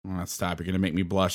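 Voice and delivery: normal talking voice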